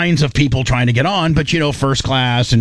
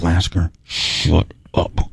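drawn-out, lower hush-up; slow low shut up; 9 semitones lower, much slower than usual. Retold or imitated. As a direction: slowly tell him to shut it. Telling Lasker to shut up.

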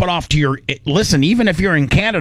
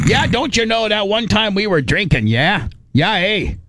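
much higher, mock Canadian drinking story; Canadian accent; 7 semitones higher than usual. Host mocking Canadian drinking stories. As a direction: ham it up, eh. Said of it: "every story has to do with drinking".

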